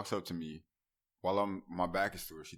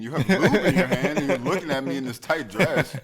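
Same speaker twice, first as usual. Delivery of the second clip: loud, high mock disbelief through laughter; laughing disbelief; 8 semitones higher, 9 dB louder than usual. Kid reacting to teacher in class, loud laughing mock disbelief. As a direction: act out racing thoughts while cracking up. Acts out his flustered thoughts, laughing.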